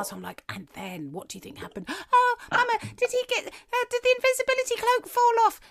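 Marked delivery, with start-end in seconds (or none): 0.5-1.9 hushed lead-up to a reveal; suspenseful storytelling. Shown as a demonstration. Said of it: "I really go into the pauses". 1.9-5.6 very high-pitched imitation of a child; eager child. Acting out children during bedtime reading.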